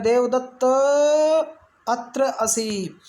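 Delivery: very slow call, the final vowel of देवदत्त stretched; calling out, pluta. Shown as a demonstration. Teacher showing how one calls someone, final vowel prolonged. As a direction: call Devadatta from afar, drawing out the last vowel. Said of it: "किसी को आवाज़ लगा रहा है".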